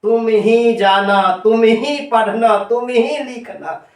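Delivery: ही pressed in each phrase, slowish; stress on ही. Shown as a demonstration. Teacher drilling emphatic examples, stress on ही. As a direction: lean on ही in तुम ही जाना, तुम ही पढ़ना, तुम ही लिखना. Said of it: "तो बलदायक निपात एक हुआ".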